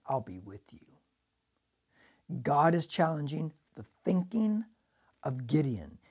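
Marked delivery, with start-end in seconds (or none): 0.1-0.9 quiet. Shown as a demonstration. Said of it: "inserted his word ... into Gideon's mind".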